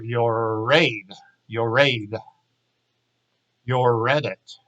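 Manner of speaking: ordinary delivery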